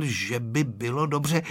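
ordinary delivery

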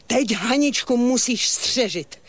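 high urgency, climbing to a cry; urgent command. Acted. Old woman dispatches siskin to guard the girl, urgent. Sends siskin to protect Hanička.

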